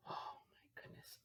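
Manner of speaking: hushed amazed whisper. Real, not acted. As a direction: breathe it in awe.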